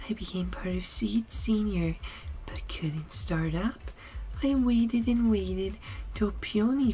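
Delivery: Seed. Acted. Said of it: "get into my Seed voice as well".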